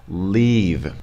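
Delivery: "Leave" with a tense, drawn-out ee; "Leave" said as LEEEV; prolonged long E. Shown as a demonstration. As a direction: stretch the ee of "Leave". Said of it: "it's kind of a prolonged".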